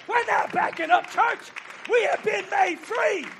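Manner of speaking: rally the church, hammering each line; fiery revival call; full-force preaching. Acted.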